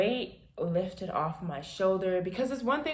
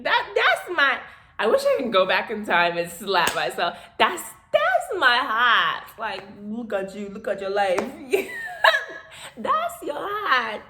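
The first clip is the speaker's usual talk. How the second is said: laughing self-mockery; 4 semitones higher, 10 dB louder than usual. Real, not acted. Mocking her past self, laughing.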